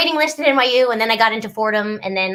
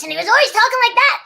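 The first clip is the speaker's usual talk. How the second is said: higher, fast, gruff monster talk; 12 semitones higher, faster than usual. Shown as a demonstration. Recalling the monster role, fast gruff monster voice. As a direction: snap it out like Henry Hucklemonster. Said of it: "he had a gruff voice".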